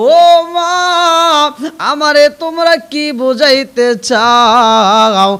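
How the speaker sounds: loud wailing cries with drawn-out vowels; sobbing singsong protest; weeping lament. Acted. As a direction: act out the widowed mother crying. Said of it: "কান্দার কয়".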